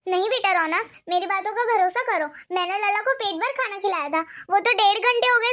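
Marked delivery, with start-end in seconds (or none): none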